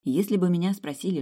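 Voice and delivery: plain reading voice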